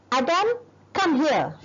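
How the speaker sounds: sharp high call to come; high-pitched command. Shown as a demonstration. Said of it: "I'm gonna use a high-pitched voice".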